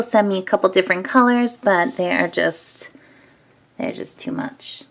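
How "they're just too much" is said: whispering. Real, not acted. Squishy review, whispering close.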